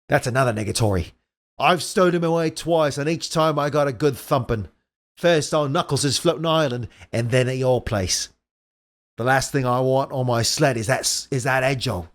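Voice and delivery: complaining weasel in fake Australian; bad Australian accent. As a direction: grumble through a shaky Australian-Cockney accent. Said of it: "that's a shitty Australian accent".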